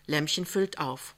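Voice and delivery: usual speaking voice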